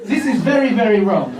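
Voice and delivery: fussy mock-German manager; German accent. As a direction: scold the team in a German accent. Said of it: "is it my accent?".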